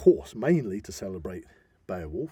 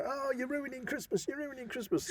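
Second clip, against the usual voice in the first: much higher, whiny nagging voice; mock accusation; 5 dB quieter than usual. Mocking people who blame you for ruining Christmas, high whiny voice. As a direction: whine it like a scolding relative. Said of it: "People say".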